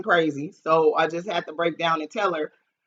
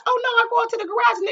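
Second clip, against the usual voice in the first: normal talking voice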